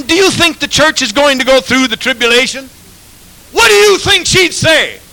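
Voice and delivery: high, loud shouting preacher; full-force revival preaching; fiery preaching. Acted. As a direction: hammer it home from the pulpit.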